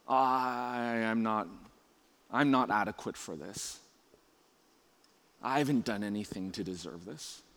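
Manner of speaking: low, soft, hesitant thinking aloud; quiet self-doubt. Acted. Preacher voices Jesus' private thoughts before baptism, quiet self-doubt. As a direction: drop low and hushed, as if unworthy.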